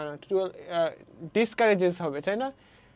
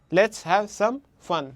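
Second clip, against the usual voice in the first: very slow, word by word to "fun"; stress on FUN; much slower than usual. Shown as a demonstration. Teacher repeating the example slowly, "fun" stressed. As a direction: space the words out, land on "fun". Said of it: "stress syllable কোনটা হচ্ছে".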